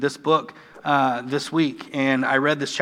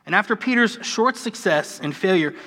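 ordinary talk

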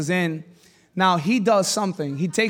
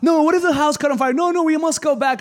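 put-on panicked girl; 6 semitones higher than usual. Scared girl insists on going back, high and anxious. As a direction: beg to turn back in a high voice. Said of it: "one of the girls got scared".

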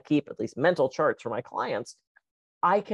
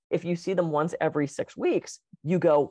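ordinary delivery

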